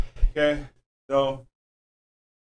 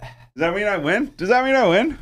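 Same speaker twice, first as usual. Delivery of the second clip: higher and louder each time; gloating excited rise; 9 semitones higher, 5 dB louder than usual. Acted. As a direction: rub the win in, getting higher. Repeats, rising excitedly, gloating.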